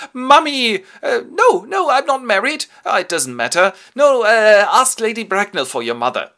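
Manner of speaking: high, loud overjoyed cry; hammed-up high ecstatic shout; mock excited outburst. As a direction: burst out like a reunited son. Jack embraces his supposed mother.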